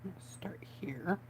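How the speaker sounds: slow soft whisper to yourself. Real, not acted. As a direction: concentrate and whisper the step. Quiet focus on craft step.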